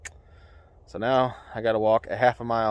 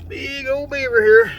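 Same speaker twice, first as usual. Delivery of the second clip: excited; 13 semitones higher, 8 dB louder, slower than usual. Real, not acted. Proud catch of a big beaver.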